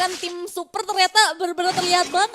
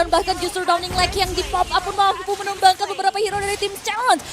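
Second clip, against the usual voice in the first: loud, higher hype commentary; esports caster; 5 semitones higher, 7 dB louder than usual. Acted. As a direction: build the triple kill call.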